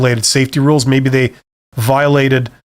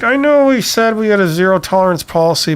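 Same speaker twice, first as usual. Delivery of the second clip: higher-pitched, mock backing down; caving manager; 6 semitones higher than usual. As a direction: imitate a manager giving in on the policy. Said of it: "seen some managers cave".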